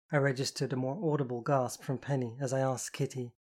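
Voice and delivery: ordinary delivery, nothing marked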